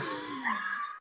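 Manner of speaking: high shriek of laughter. Real, not acted. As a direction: cry out with laughter. Laughs at pun.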